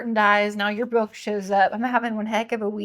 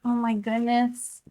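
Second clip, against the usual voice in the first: normal talking voice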